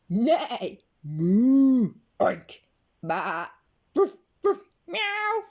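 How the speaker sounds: loud farmyard animal calls; animal noises. Acted. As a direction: bellow each animal noise. Animals make a deafening sound.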